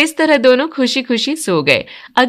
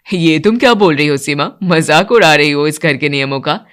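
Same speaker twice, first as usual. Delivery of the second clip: much lower, steady male voice; older man; 7 semitones lower than usual. Acted. Father and son praising the bride's cooking, much lower voice. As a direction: speak as the elder men of the house. Vijay rebukes wife.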